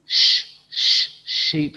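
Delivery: "Sh, sh" hissed unvoiced, then "sheep"; teacher models the sh sound for the class; sound then word. Shown as a demonstration. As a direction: stretch the sh before "sheep".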